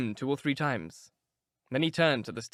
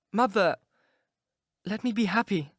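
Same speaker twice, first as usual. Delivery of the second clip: put-on a higher, begging young girl; pleading girl; 7 semitones higher than usual. A narrator's reading. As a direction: beg her mother, gently insistent. Sybil pleading again.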